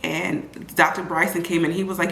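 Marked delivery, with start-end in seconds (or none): none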